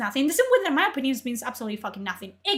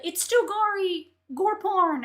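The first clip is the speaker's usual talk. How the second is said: higher, slow prissy scolding voice; mocking moral police; 6 semitones higher, slower than usual. Streamer mocks the moral police about gore, high, slow mock voice. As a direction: mimic a pearl-clutching critic, much higher. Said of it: "moral police of, like".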